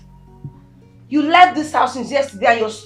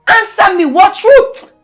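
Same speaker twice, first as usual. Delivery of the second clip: angry demand; 7 semitones higher, 5 dB louder, slower than usual. Acted. Demanding answer in quarrel.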